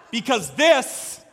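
loud, high-pitched proclamation; forceful pulpit declaration with weighty key words; emphatic preaching. Real, not acted. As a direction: drive it home to the congregation. Preacher drives home the main point.

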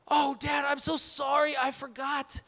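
put-on high-pitched little-kid apology; playfully mimicked child; three-year-old daughter. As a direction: imitate a small child saying sorry to Dad. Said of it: "And she says".

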